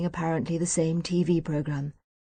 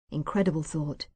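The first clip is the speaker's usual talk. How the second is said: usual speaking voice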